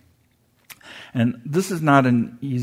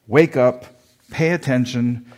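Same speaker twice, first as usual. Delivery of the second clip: mock yell; 4 dB louder than usual. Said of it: "They're yelling".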